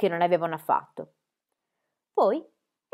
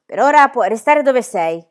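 stern teacher; 4 semitones higher, 11 dB louder than usual. Acted. Stern teacher answers Pippi.

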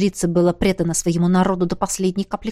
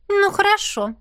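high princess voice; 13 semitones higher than usual. A narrator's reading. Princess relenting.

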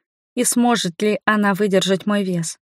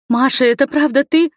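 higher, rising, amazed question; disbelieving question; 5 semitones higher, 4 dB louder than usual. A narrator's reading. Heroine facing her lost sister, hopeful disbelief. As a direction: ask with fragile hope, rising at the end.

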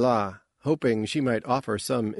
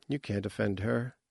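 ordinary delivery

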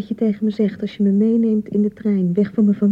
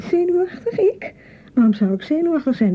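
high, incredulous yelp of outrage; indignant surprise; 10 semitones higher than usual. Acted. Bride scoffs at husband calling her nervous, indignant and high. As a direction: throw the word back at him, much higher.

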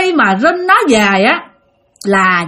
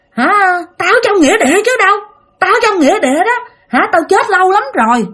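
put-on high, quick demon-child retort; possessed boy; 8 semitones higher than usual. Demon in the boy answers the elder, high defiant voice. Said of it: "thằng Tèo mới trả lời".

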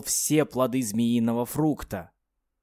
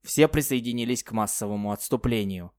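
plain reading voice

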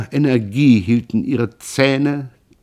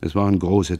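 ordinary delivery, nothing marked

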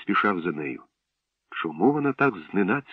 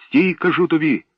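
higher, louder shouted order; 8 semitones higher, 6 dB louder than usual. A narrator's reading. Boy chases thief, shouting loud order. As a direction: yell it after him, stressing кажу. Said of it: "закричав він".

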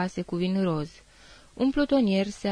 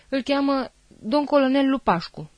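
louder, higher panicked shout; desperate cry; 6 semitones higher, 4 dB louder than usual. Acted. Frightened soldier shouting a name in desperation. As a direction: blurt it out in desperate fear. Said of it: "strigă disperat Văraru".

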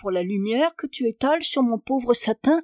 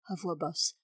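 much softer and lower, nearly under the breath; low aside; 4 semitones lower, 12 dB quieter than usual. A narrator's reading. Baroness turns aside from the visitor at the door, hushed. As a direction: drop the voice right down.

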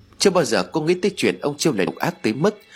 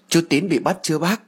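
lightly voiced little boy, higher; a cheerful little ghost boy; child's voice; 7 semitones higher than usual. A narrator's reading. Small son answering his mother in a light child voice.

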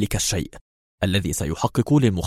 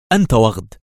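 short, louder indignant outburst; screamed insult; 5 dB louder than usual. A narrator's reading. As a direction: throw the insult out in a restrained yell. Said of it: "صرخت". (صرخة غاضبة قصيرة)

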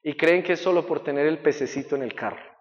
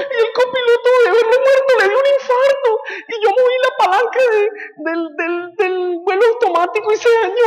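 panicked shrieking attendant, much higher, louder; flight attendant; 9 dB louder than usual. Acted. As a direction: screech in panic like a terrified attendant.

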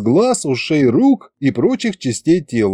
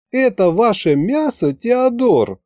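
higher, wearily sighed words; sighing complaint; 10 semitones higher than usual. A narrator's reading. Masha reproaching Teodor about meat, sighing. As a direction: complain with a light sigh. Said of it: "вздохнула Маша".

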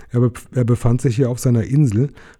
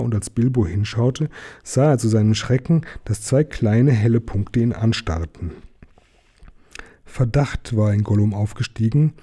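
ordinary delivery, nothing marked

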